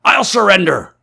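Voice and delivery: high, loud cry of surrender. A narrator's reading. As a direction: cry out to stop the fight. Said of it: "cried the stranger".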